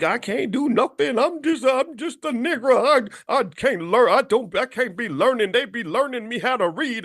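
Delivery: mocking a man who says he can't learn; mock helpless whine. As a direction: play someone who has given up on himself. Mocking a self-defeating mindset.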